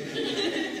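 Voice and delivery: high, loud burst of laughter; real high-pitched laugh. Real, not acted. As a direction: break out laughing mid-story. Laughing at retold exchange.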